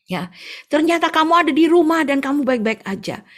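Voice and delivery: high, loud urgent telling; urgent neighbour. Acted. Neighbour telling of the deadly crash, high urgent voice. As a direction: rush the news, shaken. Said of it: "si ibu tetangga bilang gini".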